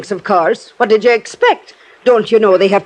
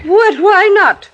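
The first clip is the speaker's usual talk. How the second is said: high sharp what, why not; indignant surprise; 12 semitones higher, slower than usual. Acted. Landlady to Johnny, indignant at being doubted. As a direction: bristle at the question.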